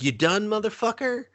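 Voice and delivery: high-pitched imitation of a taunting yell; play-acted monster yelling at a rival; Godzilla. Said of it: "Godzilla yells at Kong".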